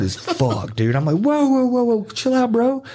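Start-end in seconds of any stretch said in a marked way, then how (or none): 1.1-2.8 very high alarmed whoas; retold high-pitched calming plea; startled protest. Retold reaction to blasting radio.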